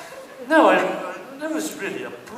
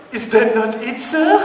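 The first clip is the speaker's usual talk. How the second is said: desk lady; 6 semitones higher, 4 dB louder than usual. Retold or imitated. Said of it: "desk lady voice".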